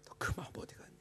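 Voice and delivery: unvoiced whisper, 마음 stressed; whispered voice of God. Pastor repeating the Lord's question in a whisper. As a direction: breathe the question again. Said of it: "주님이 그걸 보여주시면서 저에게".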